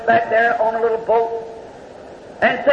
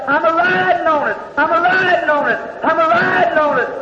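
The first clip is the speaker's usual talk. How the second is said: joyful cry; 6 semitones higher than usual. Acted. Dreamer wakes up shouting the line.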